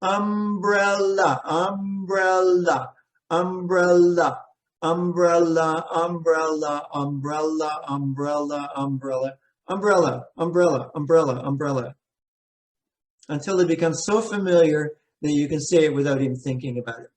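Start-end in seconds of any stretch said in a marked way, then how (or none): 0.0-11.9 "Umbrella" drawn out slowly, syllable by syllable, again and again; broken into sounds. Retold or imitated. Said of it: "she broke it down into sounds".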